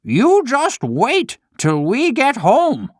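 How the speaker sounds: high, slow, mock furious threat; angry shout. Old father threatening the naughty puppet, mock angry. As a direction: wag a finger with the words. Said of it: "was angry and shouted".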